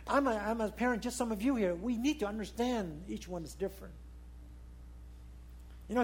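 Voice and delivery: ordinary talk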